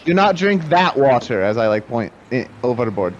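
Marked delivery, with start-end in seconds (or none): 0.1-1.4 "do not drink that water" raised, with a punch on "not"; high, firm stress on "not"; insistent warning. Acted. Dwarf warns companion off the water, insistent "not".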